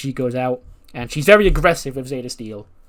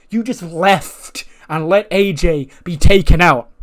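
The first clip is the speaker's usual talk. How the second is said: mock angry scolding; 4 semitones higher, 8 dB louder than usual. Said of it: "And Bayley goes".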